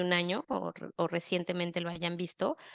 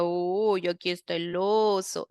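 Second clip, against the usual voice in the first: put-on lower playful baby talk. Shown as a demonstration.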